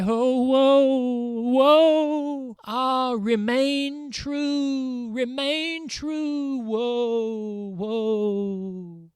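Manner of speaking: high, slow fading lament; sobbing waves. A narrator's reading. The waves lamenting after Undine, fading sobs. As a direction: let the wail die away over the water. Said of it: "waves were yet whispering and sobbing".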